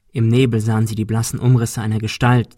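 ordinary delivery, nothing marked

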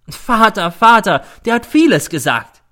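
high, loud repeated word; scornful dismissal. A narrator's reading. Mother dismissing son's words about father, scornful. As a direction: brush it off with irritation.

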